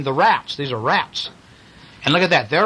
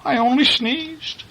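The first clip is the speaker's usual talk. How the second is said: high, slow imitation of a meek man; poor farmer; 10 semitones higher than usual. Reader retells the poor man's excuse, high meek voice. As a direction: plead innocence in a high voice. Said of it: "And the poor man says".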